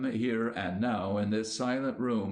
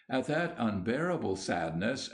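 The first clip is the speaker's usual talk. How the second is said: plain reading voice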